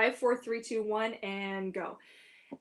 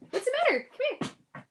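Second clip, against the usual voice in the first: much higher, sing-song pet talk; soothing the dog. Real, not acted. Owner reassures her dog in high cooing pet talk. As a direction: coo it to a scared pet.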